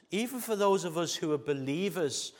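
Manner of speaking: loud, high, driving sermon voice; preaching at full force. Acted. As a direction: preach it out to the congregation.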